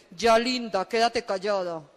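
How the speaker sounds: lightly voiced low husband; a hint of a dismissive husband; weary husband. Husband tells wife to stop, mildly weary.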